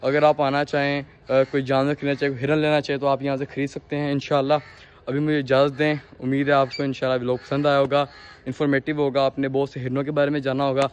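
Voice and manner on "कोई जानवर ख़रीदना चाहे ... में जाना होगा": normal talking voice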